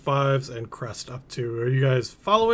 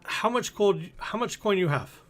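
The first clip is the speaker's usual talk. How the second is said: put-on higher-pitched Kuala Lumpur-style accent; foreign merchant accent; 7 semitones higher than usual. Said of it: "I don't know why they have this accent".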